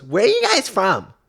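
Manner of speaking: high, drawn-out caricature question; mocking lady voice. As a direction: imitate a nosy lady, slow and high. Mimics the lady's question.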